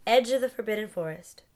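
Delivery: plain reading voice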